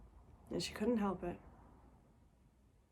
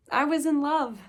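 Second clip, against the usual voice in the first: higher, drawn-out joy of being in love; elated; 4 semitones higher, slower than usual. Acted. Girl recalling coming home from school in love, elated. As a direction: let the joy lift and stretch the words.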